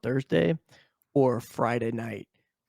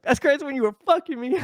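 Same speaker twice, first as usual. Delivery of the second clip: much higher, put-on lady voice; mock lady; 13 semitones higher than usual. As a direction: parody her comeback with a shrill twist. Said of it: "you're like".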